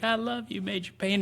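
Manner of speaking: put-on sweet little kid. Kid hugging the major.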